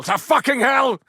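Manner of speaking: bellowed curse at the sky; furious outburst. Acted. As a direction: explode with rage. Outburst over dead comrade.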